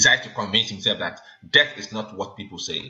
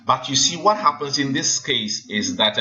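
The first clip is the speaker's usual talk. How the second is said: ordinary talk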